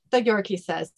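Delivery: normal talking voice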